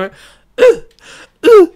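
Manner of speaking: high loud "uh, uh" like the Roblox oof; Roblox death sound. Retold or imitated. Streamer mimicking a sound from the show, high Roblox oof. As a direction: squeal it out like a game death noise. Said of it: "como el Roblox".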